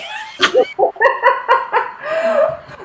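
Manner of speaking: high, airy giggle; high laugh. Acted. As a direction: let a high laugh escape. Laugh after "Eenie.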